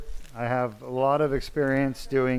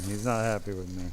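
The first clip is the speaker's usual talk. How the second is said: ordinary delivery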